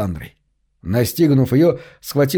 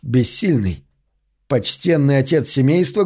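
ordinary delivery

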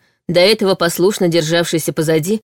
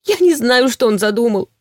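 high, frightened, close to sobbing; trembling voice; 4 semitones higher than usual. A narrator's reading. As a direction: fear breaking into tears. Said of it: "громко, испуганно разрыдалась".

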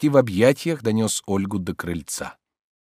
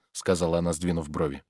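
plain reading voice

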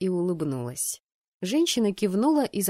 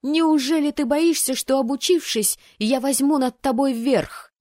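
high, loud, incredulous challenge; raised, excited disbelief; astonished taunt; 6 semitones higher, 6 dB louder than usual. Acted. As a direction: taunt him with loud amazement. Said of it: "поражённо воскликнула я".

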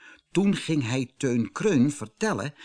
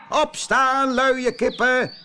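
high, loud rooster crow; 14 semitones higher, 7 dB louder, slower than usual. Acted. Rooster wakes the lazy hens, crowing loudly. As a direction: crow them awake like a bossy rooster. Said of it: "kraaide hij luid".

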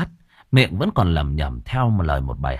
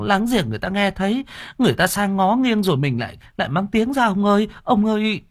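high, pleading servant's voice; pleading maid; 11 semitones higher than usual. Acted. Maid begs her master to stop, in a high pleading voice. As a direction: beg the master to calm down, high and urgent. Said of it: "miệng bà khẩn khoản".

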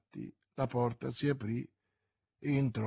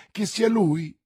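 loud, high, hopeful question; eager exclamation; 6 semitones higher, 8 dB louder than usual. A narrator's reading. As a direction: cry out in sudden hope. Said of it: "esclamò Madame Bonacieux, precipitandosi alla finestra".

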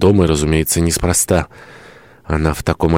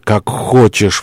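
higher, impassioned surrender; exclaiming; 4 semitones higher, much slower than usual. Acted. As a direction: give in with an outcry. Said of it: "воскликнул он".

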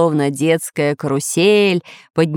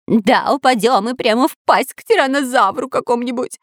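whining; 5 semitones higher than usual. Acted. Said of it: "скулил Дырка".

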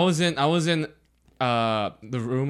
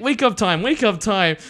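lightly voiced dad, higher, simple English; imitated host dad; 8 semitones higher, 3 dB louder than usual. Friend imitating his host's dad waking him, higher voice. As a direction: sing out like a wake-up call, imitating. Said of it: "he didn't speak English very well".

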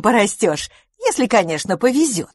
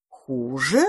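questioning; 5 semitones lower than usual. A narrator's reading. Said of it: "переспросила белка".

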